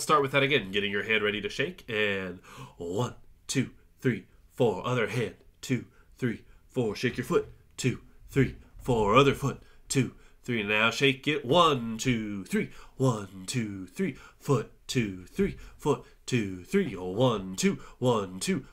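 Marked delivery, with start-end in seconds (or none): none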